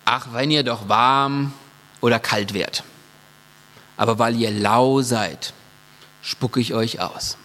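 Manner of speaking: a lightly voiced solemn Jesus. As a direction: quote Jesus slowly and gravely. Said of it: "er sagt zu dieser Gemeinde".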